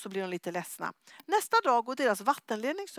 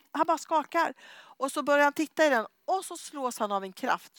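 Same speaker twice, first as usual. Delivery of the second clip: ordinary talk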